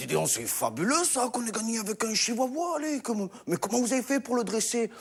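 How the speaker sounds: a king gushing with amazement; king of Belgium. Acted. King of the Belgians amazed at the chihuahua. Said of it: "le roi des Belges arrive, dit".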